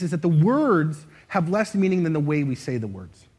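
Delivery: usual speaking voice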